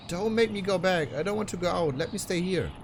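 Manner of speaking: put-on high, frightened girl begging; pleading girl voice. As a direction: beg him not to send you back.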